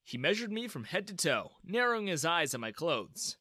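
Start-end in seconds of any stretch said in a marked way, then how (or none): none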